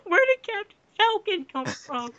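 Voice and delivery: high, crying disbelief; indignant cry. Acted. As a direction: wail it in mock outrage. Outraged cry about twist.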